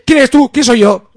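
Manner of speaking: very high, loud interrogation; furious shout. Acted. Bodyguard yelling at a businessman after the slap, high and loud. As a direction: scream it in his face.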